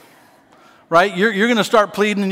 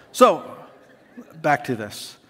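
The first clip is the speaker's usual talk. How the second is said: normal talking voice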